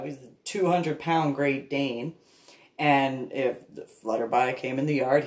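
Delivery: ordinary delivery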